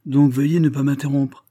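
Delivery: commanding duke silencing his son; authoritative. A narrator's reading. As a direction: cut him off with fatherly authority. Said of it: "prit un air d'autorité".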